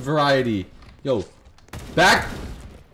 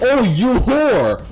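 mock taunt; 6 semitones higher, 5 dB louder, much slower than usual. Real, not acted. Gamer taunting enemy, drawn out.

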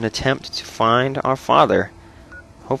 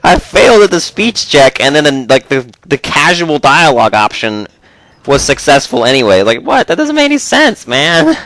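excited disbelief; 7 semitones higher, 7 dB louder than usual. Real, not acted. Ranting about the speech check.

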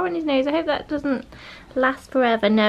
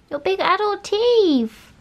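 high, slow cooing to a puppy; 7 semitones higher, slower than usual. Real, not acted. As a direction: coo it to the dog.

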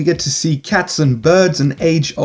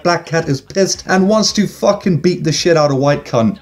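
normal talking voice

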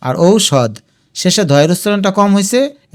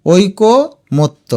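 ঐকমত্য slowly as oiko, motto; broken into parts; 2 semitones higher, much slower than usual. Shown as a demonstration. Teacher urges students to say it aloud, ঐকমত্য in slow parts. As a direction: pause in the middle of ঐকমত্য. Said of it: "তুমি মুখে উচ্চারণ করবে".

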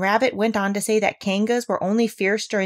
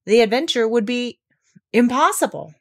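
raised, weighty proclamation with a pause; solemn; 4 semitones higher, 4 dB louder than usual. A narrator's reading. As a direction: pronounce it grandly, holding back the last word. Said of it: "said Rabbit solemnly".

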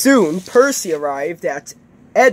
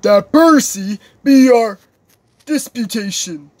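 loud, grand, higher-pitched announcement; pompous proposer; 5 semitones higher, 6 dB louder than usual. Acted. Big engine nominating the small one, pompous. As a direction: proclaim it to the assembly. Gordon's formal proposal continues.

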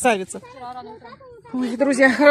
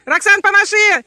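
yelling across the room; 6 semitones higher, 5 dB louder than usual. Real, not acted. Calling to girl at distance.

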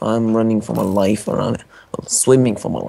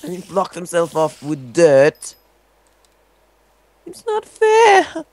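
outraged yell; 10 semitones higher, slower than usual. Real, not acted. Outraged whine.

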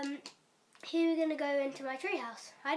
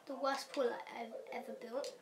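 ordinary talk, nothing marked

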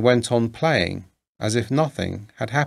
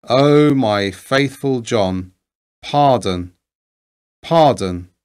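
louder, slow pleading call for pardon; anguished cry; 4 dB louder, slower than usual. A narrator's reading. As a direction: cry out begging forgiveness, drawn out. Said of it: "Then cried the king".

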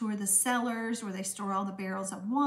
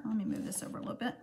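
whispered aside; 6 dB quieter, much faster than usual. Real, not acted. Aside while moving craft supplies.

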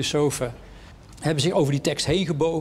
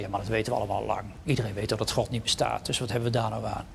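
quick, lower and softer brush-off; dismissive scoffers; 5 semitones lower, 5 dB quieter, faster than usual. Retold or imitated. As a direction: wave it away, fast and low. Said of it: "en die zeggen". (sneller, lager en zachter wegwuiven)

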